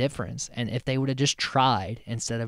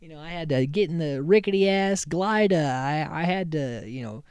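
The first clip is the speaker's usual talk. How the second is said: whiny complaint; 5 semitones higher, 4 dB louder than usual. Retold or imitated. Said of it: "And he's like".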